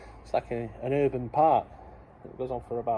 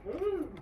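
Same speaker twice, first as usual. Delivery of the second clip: cheeky high ooh; 8 dB quieter than usual. Pretend. Suggestive reaction.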